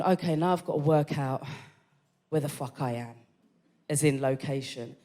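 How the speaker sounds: low, soft inner monologue; musing to self. Retold or imitated. Guest working out where she is, musing to self. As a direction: think aloud under your breath. Thinking to herself, lost.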